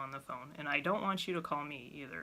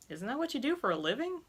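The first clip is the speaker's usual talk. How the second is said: higher, fast incredulous question; imagined listener retort; 7 semitones higher, faster than usual. Retold or imitated. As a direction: voice the listener's sceptical objection. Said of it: "imagined listener question".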